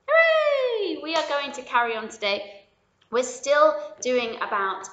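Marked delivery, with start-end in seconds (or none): 0.1-1.0 very high, loud cheer; hooray cheer. Real, not acted. Ending the song with a very high cheer for kids.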